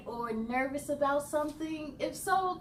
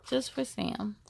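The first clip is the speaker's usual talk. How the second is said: plain reading voice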